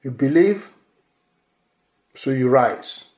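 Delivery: normal talking voice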